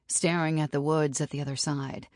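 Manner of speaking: usual speaking voice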